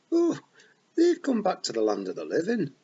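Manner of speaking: bright, cheery wee pixie voice in a Scots burr; chirpy Feagle chief, happy as you like; cheerful Rob Anybody. A narrator's reading. Rob Anybody cheerfully tells Tiffany the dead come back, cheery. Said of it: "said Rob Anybody cheerfully".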